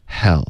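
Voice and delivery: "hell" opening on a light sigh; sighed English h. Shown as a demonstration. Teacher corrects a harsh h, sighed "hell". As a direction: let the h in "hell" be breath without friction. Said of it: "英文的h只是一个叹气的声音".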